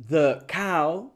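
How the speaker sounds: "the cow" with "the" as a short thuh; weak "the". Shown as a demonstration. Teacher models article before a consonant, schwa thuh. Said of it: "Es the".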